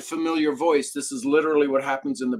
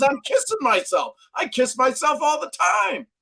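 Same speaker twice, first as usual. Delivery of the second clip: much higher, shouted boast; playful shout; 12 semitones higher than usual. Retold or imitated. Mentor tells the caller he kisses himself, high and shouted.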